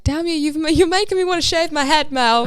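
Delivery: jokingly cursing a friend for looking great; mock accusing. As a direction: tease her as if blaming her. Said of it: "I was just like".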